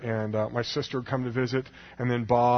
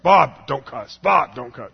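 firm, louder mock reprimand; scolding Bob; 7 dB louder, slower than usual. Scolding friend Bob for cussing, firm and louder. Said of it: "So anytime he cussed".

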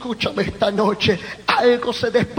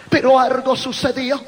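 ordinary talk

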